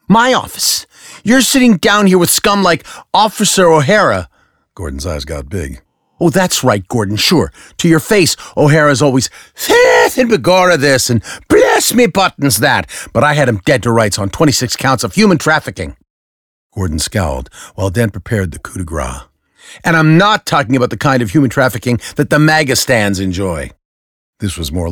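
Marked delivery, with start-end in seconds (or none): 0.1-4.3 high, loud, outraged retort; spitting Dent. Acted. Dent spitting back at Gordon, high and outraged. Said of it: "Dent's turn to spit". 6.2-23.7 high, loud, smug comeback; smug Dent. Acted. Dent gloating at Gordon, high and smug.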